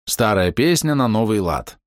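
usual speaking voice

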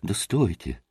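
low, soft first call to wait; restrained call. A narrator's reading. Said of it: "силился всех перекричать Красоткин".

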